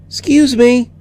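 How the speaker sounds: put-on small high child's voice; high, timid little girl. A narrator's reading.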